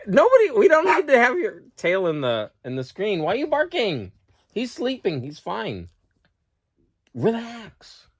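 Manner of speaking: calm the barking dog down; talking to the dog. Real, not acted.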